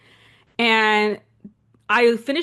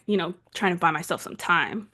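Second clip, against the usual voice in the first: ordinary talk, nothing marked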